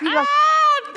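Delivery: loud, very high, held "ah"; delighted high-pitched squeal. Real, not acted. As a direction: squeal out one long high note. Reacts to super chat donation.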